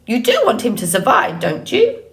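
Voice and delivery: quick, swooping bird voice rising high at the end; prodding old goose. Acted. Goose testing the robot's intentions, pointed squawk. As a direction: press the point like a pushy goose.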